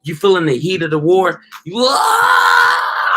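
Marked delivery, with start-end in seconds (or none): 1.8-3.2 sudden high shouted battle cry; war cry. Retold or imitated. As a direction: let out a loud war yell as if charging. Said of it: "it's a war cry".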